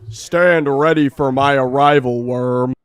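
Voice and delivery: loud, commanding alien warlord; menacing conqueror. Acted. Conquest scolding the hero, loud menace. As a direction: boom down at a lesser being.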